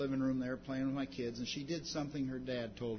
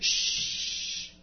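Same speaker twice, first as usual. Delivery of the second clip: a sharp, loud shush, then a scolding; hushing the beggar; 10 semitones higher, 8 dB louder, much slower than usual. Retold or imitated. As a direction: shush him hard to keep him away from Jesus. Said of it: "They said".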